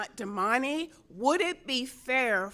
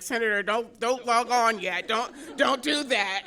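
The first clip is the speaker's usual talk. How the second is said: louder urgent warning; imitating a worried assistant, louder; 3 semitones higher, 8 dB louder than usual. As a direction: stop her from logging on. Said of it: "she's like".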